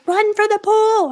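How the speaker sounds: high, slow yell of a panicked mom; imitating mom's panicked yell. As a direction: mom shouting orders in alarm. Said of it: "My mom's like".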